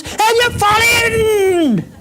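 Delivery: very high, slow shouted cry; dramatic cry. Pretend. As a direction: let the fall burst out as a shock. Sudden disaster in story.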